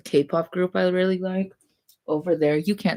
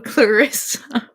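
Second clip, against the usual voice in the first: laughing; 5 semitones higher, 5 dB louder, slower than usual. Real, not acted. Teasing friend with a laugh.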